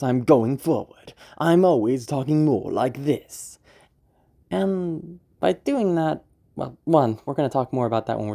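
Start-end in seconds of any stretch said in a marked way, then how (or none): 0.0-3.6 slow, louder, every word pushed forward; gung-ho emphasis; emphatic confident voice. Shown as a demonstration. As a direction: lean into each word like a hero. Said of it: "I'm emphasizing things".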